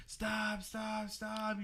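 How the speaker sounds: high-pitched mock crying plea; retold crying. Chasing his cousins' car, imitated crying plea. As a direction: plead in a high voice, imitating tears. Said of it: "like, crying".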